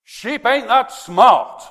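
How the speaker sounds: preacher booming a punchline; emphatic punchline. Real, not acted. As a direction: land the joke with a big booming voice. Preacher punchline, loud and slow.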